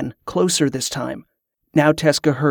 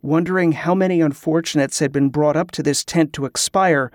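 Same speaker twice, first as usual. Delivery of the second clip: plain reading voice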